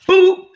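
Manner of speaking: a sharp, high, loud "Boop!"; mock boop. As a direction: pop a loud high boop to mark an entrance. Sound effect for his arrival.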